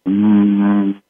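long, steady imitated hum; low droning hum. Shown as a demonstration. Showing what we hear inside our heads, droning hum. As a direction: hum like the muffled buzz inside a speaker. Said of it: "all the hum noise".

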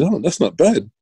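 casual understatement. Retold or imitated. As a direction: shrug it off like it's nothing special. Coach watching stopwatch, understated.

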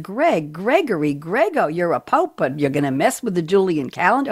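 swooping New York mother scolding; hammed-up New York mom; New York accent. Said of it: "I'm doing my New York accent".

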